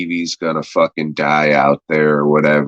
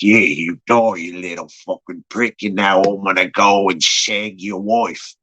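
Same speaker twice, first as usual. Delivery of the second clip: put-on British accent, taunting; gloating British villain. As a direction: gloat over the dying man in a British accent.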